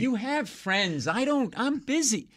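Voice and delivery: high, drawn-out fed-up protest; mock exasperation. Comic brushing off caller he knows, mock exasperation. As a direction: protest like a pestered man, hammed up. Acted annoyance at repeated caller.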